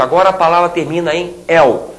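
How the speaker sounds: normal talking voice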